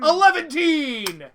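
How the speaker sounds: loud drawn-out shout. Acted. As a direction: yell the last count. Count spring shout.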